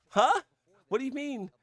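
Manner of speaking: high-pitched puzzled questioning; incredulous. Real, not acted. Streamer questioning chat's no, incredulous. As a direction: pitch shoots up in disbelief.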